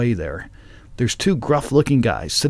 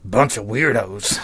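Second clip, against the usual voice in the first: slow, lightly voiced biker; gruff biker; 2 semitones lower than usual. Biker remarking on the strangers to his mate, slow and mildly gruff. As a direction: draw it out slowly as a biker. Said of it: "All bikers sound like that".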